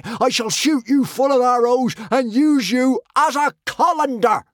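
high, loud outraged screaming; shrieking high-pitched warrior rage; yelling. A narrator's reading. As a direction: bellow threats in hysterical fury. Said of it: "yelled the hysteric with the axe".

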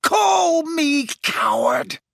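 high, drawn-out demented scream. A narrator's reading. Snape rages at being called coward, screaming. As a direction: scream it slowly, in pain. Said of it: "screamed Snape".

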